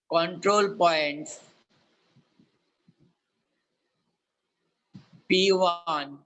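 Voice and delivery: slow, clear dictation with long pauses; dictating. Acted. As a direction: dictate each word slowly for note-taking. Teacher dictates the problem statement.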